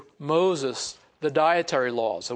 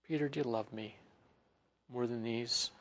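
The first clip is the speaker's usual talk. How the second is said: tender question; 4 semitones lower, 13 dB quieter than usual. Acted. The Lord asks Peter.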